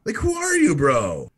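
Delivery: imitating Jay's offended comeback; incredulous retort. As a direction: throw it back with disbelief.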